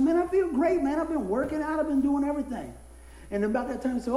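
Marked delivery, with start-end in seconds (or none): 0.0-2.7 high, loud cheerful bragging; playing himself as cheerfully fit; upbeat boasting. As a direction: brag about feeling great. Retold boasting to doctor.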